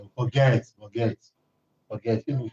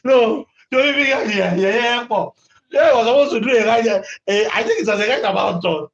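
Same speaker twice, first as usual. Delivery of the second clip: shouting; 7 semitones higher than usual. Real, not acted. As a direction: shout the story out, laughing at it. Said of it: "I should stop shouting".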